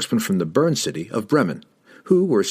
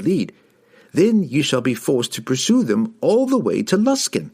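higher-pitched halfling voice, earnest; halfling informant; 4 semitones higher than usual. Acted. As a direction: lay out the enemy's plan in a raised, emphatic voice.

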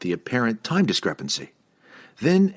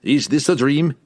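higher-pitched rising question "Is this a dream?"; incredulous question, voice raised; 6 semitones higher, 4 dB louder than usual. A narrator's reading. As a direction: ask "dream" with a lift, doubtfully. Posed as challenge to dwarf's claim.

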